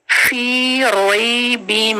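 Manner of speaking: फी रोई बिम slow, meem held two counts; slow recitation; tajweed recitation. Shown as a demonstration. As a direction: hold the मीम in फी रोई बिम. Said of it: "दोनों को time देना है".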